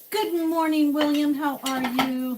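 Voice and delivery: high, loud sing-song hello; cheery high greeting. Pretend. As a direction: greet him brightly like a morning show.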